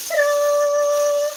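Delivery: high, loud fanfare; hammed-up big reveal; showy ta-da. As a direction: present it like a magician's reveal. Shows off the bread loaf.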